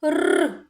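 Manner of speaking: "Р" as a long high trill; isolated sound. Shown as a demonstration. Teacher answers which sound, long trilled Р. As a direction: roll a bare "Р". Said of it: "Який звук?".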